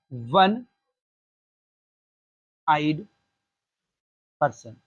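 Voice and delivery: वन आइड person spaced out with long pauses; slow dictation of वन आइड person; word by word. Shown as a demonstration. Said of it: "ठीक है".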